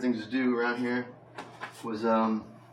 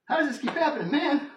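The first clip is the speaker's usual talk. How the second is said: laughing exasperation. Retold or imitated. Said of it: "And I was like".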